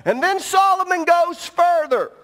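high and loud pulpit call; preaching at full force. Acted. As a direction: drive it home, wake them up.

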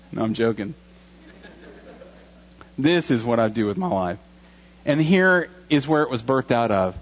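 usual speaking voice

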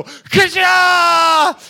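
loud high crash sound effect; glass smash effect. Retold or imitated. Fist going through the car window, loud crash sound. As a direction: blast out the smash noise.

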